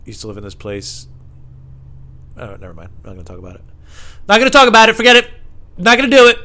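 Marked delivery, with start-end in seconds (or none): 2.4-3.6 low, soft backing off; quiet dismissal. Real, not acted. Comic abandoning his own story, low and quiet. Quiet refusal before shouted repeat. 4.3-6.4 high, loud refusal; mock outburst. Comic refusing to tell his own story, sudden mock shouting.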